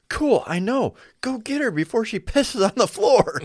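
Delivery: high, loud sarcastic brush-off; exasperated dad. Retold or imitated. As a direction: shoo the kid off in exasperation. Father dismissing child.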